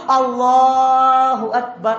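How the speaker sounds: slow, stretched Allahu Akbar with a long Allah; drawn-out takbir. Shown as a demonstration. Preacher showing worshippers the opening takbir, slow and drawn out. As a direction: stretch Allah out like at the start of prayer.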